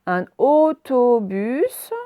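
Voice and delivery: "autobus" slowly, both /o/ held; stretched O sounds. Shown as a demonstration. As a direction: dwell on "au" and "to" in "autobus". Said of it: "donc on l'entend deux fois". (« autobus », les deux O allongés)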